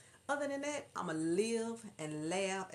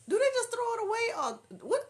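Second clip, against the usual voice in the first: high, loud bewildered exclamation; loud squeal of disbelief; incredulous; 7 dB louder than usual. Real, not acted. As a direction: ask it at a high shout, baffled. Incredulous reaction to the story.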